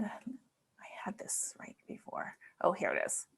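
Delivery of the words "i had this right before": whisper. Real, not acted. Muttering while searching screen.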